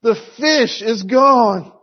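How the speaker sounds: high, drawn-out child's wail, mimicked; retold distressed cry. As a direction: mimic a little girl crying out to her dad. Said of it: "she came running back downstairs sobbing. And she cried out".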